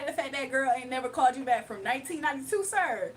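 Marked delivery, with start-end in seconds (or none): none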